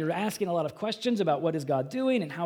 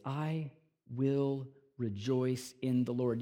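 softer, slower I will rejoice in the Lord; preacher quietly savouring the verse; 5 semitones lower, 7 dB quieter, slower than usual. Shown as a demonstration. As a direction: let "will" linger softly and slowly. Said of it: "He can say this".

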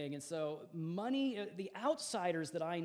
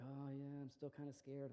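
quiet imitation of son; 2 semitones lower, 11 dB quieter than usual. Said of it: "he's like".

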